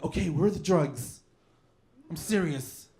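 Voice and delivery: disguised, slightly effeminate superhero voice; lightly camp interrogation; effeminate Catman voice. Acted. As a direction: play a dainty vigilante cornering a dealer. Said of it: "Catman sounds like this".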